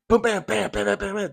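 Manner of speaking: high-pitched rattle of bangs; imitated gunfire. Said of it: "just the gunfire is just".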